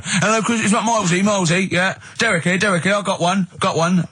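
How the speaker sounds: high eager phone-in caller; excited radio caller desperate to get on air; imitating an eager caller phoning Chris. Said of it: "wanted to get through and go".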